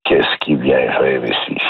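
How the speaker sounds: low, wary mutter about a stranger; suspicious villager. Acted. Small-town local watching a newcomer through the window, suspicious.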